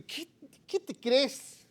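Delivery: high, slow Chilean indignation; Chilean outburst. Retold or imitated. Preacher playing himself, Chilean indignation. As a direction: snap back like an offended Chilean. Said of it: "se me sale el chileno".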